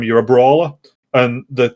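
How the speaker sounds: ordinary talk, nothing marked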